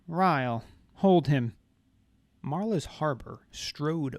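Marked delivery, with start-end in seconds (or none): 0.1-1.5 loud sharp order; commanding. A narrator's reading. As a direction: bark it as an order. Marla orders her harbor.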